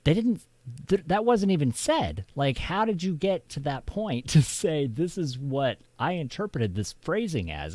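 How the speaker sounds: exasperated disbelief. Retold or imitated. Finding the real transcript, he exclaims in high disbelief. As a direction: scoff at the bogus summary. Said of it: "and be like".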